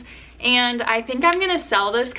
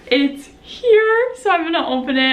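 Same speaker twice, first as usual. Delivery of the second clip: high, loud excited squeal; 7 semitones higher, 5 dB louder than usual. Real, not acted. Unboxing a long-awaited book for viewers, excited. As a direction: squeal it out, really thrilled. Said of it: "I'm so excited".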